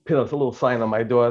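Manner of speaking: normal talking voice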